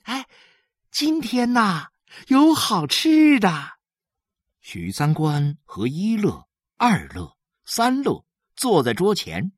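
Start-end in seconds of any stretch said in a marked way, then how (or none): 0.0-3.8 high, teasing mother with a treat to reveal; grinning, sing-out good news; gleeful announcement. A narrator's reading. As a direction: draw out the last words happily, pitched high. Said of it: "笑嘻嘻地告诉他们".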